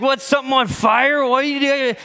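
high, loud-ish squawk of a dad jolted awake; startled, just-woken dad. Retold or imitated. Father-in-law woken at night by his wife, startled squawk. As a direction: imitate a man yanked out of dead sleep. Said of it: "squinting like this out of a dead sleep".

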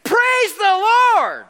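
high, drawn-out roar of praise; loud, slow, soaring acclamation; multitude's shout. Acted. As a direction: belt it out like a crowd in heaven. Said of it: "great multitude".

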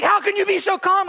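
hammed-up amazed wife. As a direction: imitate the wife baffled at his calm. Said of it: "She's like".

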